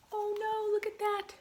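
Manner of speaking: usual speaking voice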